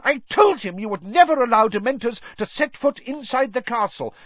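high, loud angry outburst; storming. Acted. McGonagall storming at Fudge over the Dementor. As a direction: storm at him in fury. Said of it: "stormed Professor McGonagall".

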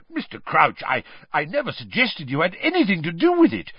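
plain reading voice

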